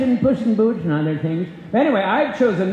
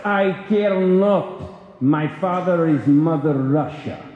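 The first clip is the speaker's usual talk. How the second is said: slow, lightly Russian mob boss; a hint of a Russian gangster, taking his time; Russian mob accent; 3 semitones lower, slower than usual. [name] as Big Mob Man taunting John Wick, light Russian accent. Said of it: "your Russian, uh, mob accent there".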